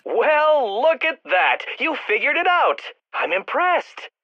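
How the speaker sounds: high-pitched, exaggerated praise; mock congratulation. Villain to Batman who solved it, mock-impressed. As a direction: feign being impressed, condescendingly.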